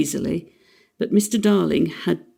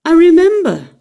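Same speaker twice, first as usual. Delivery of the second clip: wistful; 10 semitones higher, 9 dB louder than usual. Acted. Fond recollection of children.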